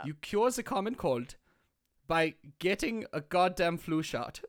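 put-on German-accented doctor giving orders; measured mock-German reassurance. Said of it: "if I had a German accent".

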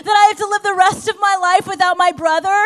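high, loud, grieving protest; acted grief-stricken outcry; anguished confrontation. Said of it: "she was in such agony".

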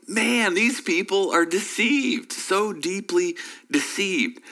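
slow incredulous remark. Retold or imitated. Listener voiced, slow, far timbre.

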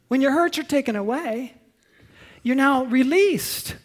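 normal talking voice

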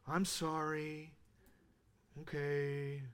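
low, soft, drawn-out "I'm sorry"; half-hearted apology. Shown as a demonstration. Father mimicking his own apologies at home, half-hearted. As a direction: mumble a slow apology that lacks depth. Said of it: "That's the way I say it".

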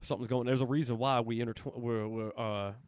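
lightly voiced [name] thinking aloud; mumbling [name] impression; [name] musing. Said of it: "he's like".

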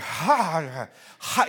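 breathy huffs to cool a burning mouth; acted "ha" exhales through pain; mouth-on-fire panting. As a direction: huff out the heat. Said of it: "when you're talking and your mouth is on fire".

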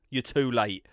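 ordinary delivery

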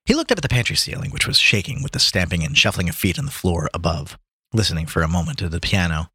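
plain reading voice

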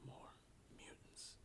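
breathy whispered line; dramatic comic-book whisper. Retold or imitated. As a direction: whisper it like a secret. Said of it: "she goes".

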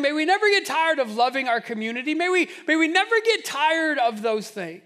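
loud, high, building exhortation; full-force preaching. Acted. Preacher urges the church, loud and rising. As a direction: drive it home to the congregation.

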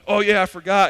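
put-on kids' offhand excuse. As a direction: imitate a kid suddenly remembering a forgotten chore. Kids' reply acted out.

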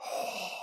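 long, hollow masked breath; slow mechanical villain breath; Darth Vader breathing. Retold or imitated. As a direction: breathe heavily as if through a helmet. And I was just like.

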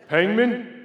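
loud, high questioning summons; mock the curt call, loudly; exaggerated waiting-room shout of a surname; nurse's name call. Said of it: "that's all they said to me".

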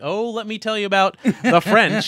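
high, loud imitation of a keen friend, laughing; mock-eager impression. Teasing a friend over their eager comic-book lecture, mock-excited. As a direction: play up a friend's eagerness to explain.

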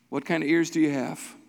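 ordinary talk

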